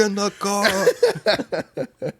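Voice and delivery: high, slow, drawn-out mock yell; mock husband yelling. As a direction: imitate the husband calling her back. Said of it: "the husband yelling at her".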